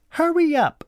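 very high, impatient command; high-pitched bossy child voice; bossy cousin. A narrator's reading. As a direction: order them about like a bossy girl. Bossy cousin hurries them.

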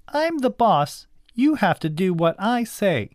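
put-on high-pitched bossy girl; high voice of a child in charge; bossy cousin. A narrator's reading. Bossy cousin asserts herself.

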